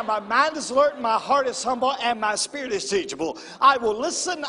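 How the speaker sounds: loud, high call to the congregation; rally the room to stand and recite; preaching to congregation. Acted. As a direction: pastor leading the church in a loud creed. Leads congregation in a loud creed.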